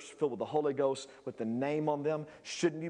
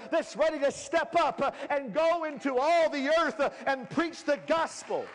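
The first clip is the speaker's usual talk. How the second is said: raised, rhythmic preacher cadence; preaching; 10 semitones higher, 3 dB louder than usual. Acted. Pastor rallying the church, preaching with rising fervor. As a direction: preach it to the congregation.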